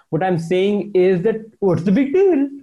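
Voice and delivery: ordinary talk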